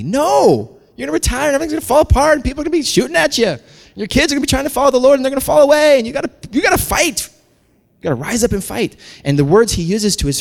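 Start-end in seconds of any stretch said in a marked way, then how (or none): 0.0-9.0 high, loud, driving sermon shout; impassioned warning to the flock; full-force preaching. Real, not acted. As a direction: preach it urgently at full volume.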